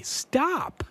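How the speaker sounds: high pleading stop; urgent plea. Retold or imitated. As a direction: beg them to stop.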